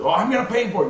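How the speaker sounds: high-pitched imitation of an insistent wife; wife's protest. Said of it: "She tries to make the argument".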